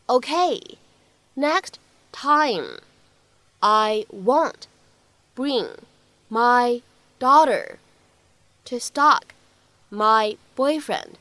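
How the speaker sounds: each word spaced out, slow; slow word-by-word drill. Shown as a demonstration. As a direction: break the line into single slow words. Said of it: "需要注意的发音技巧". (逐词慢读示范)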